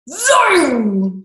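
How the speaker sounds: put-on a playful sound effect for the children; zoom sound effect. Shown as a demonstration. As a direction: fling out "Zoom!" like a racing frog. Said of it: "I need to give you some sound effects".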